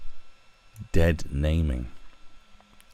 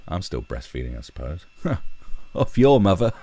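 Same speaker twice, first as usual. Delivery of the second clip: ordinary delivery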